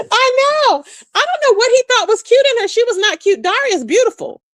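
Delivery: high, fast, incredulous; excited. Real, not acted. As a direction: rush it out in disbelief.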